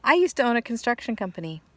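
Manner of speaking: eager boast, acted out; excited retelling. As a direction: replay "I used to own a construction company" with mock gusto. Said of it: "he goes".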